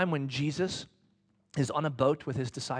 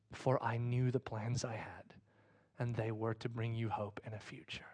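breathy whispered promise; hushed whisper; 4 semitones lower, 7 dB quieter than usual. Acted. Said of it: "those words".

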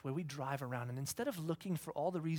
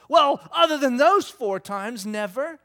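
put-on sarcastic kid; 7 semitones higher, 15 dB louder than usual. Kid's sarcastic reply.

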